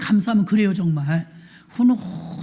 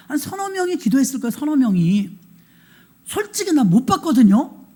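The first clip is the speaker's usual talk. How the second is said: usual speaking voice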